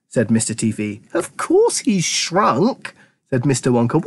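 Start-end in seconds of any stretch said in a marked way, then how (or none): 1.1-2.9 high, very slow, drawn-out reply; breezy matter-of-course. A narrator's reading. Wonka answering the worried father, breezily. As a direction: stretch it as if obvious.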